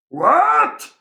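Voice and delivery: loud high shout; shouted what. Acted. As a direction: bark the question. Loud grocer can't hear.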